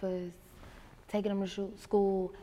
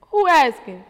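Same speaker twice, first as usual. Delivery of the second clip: sassy retort; 7 semitones higher, 16 dB louder than usual. Retold or imitated. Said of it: "So I'm like".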